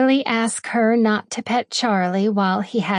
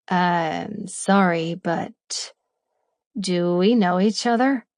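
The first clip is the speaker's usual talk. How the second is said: slow, low, puzzled man; a deep voice with a hint of Southern; deep man's voice; 3 semitones lower, slower than usual. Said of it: "his voice deep with only the slightest touch of a Southern".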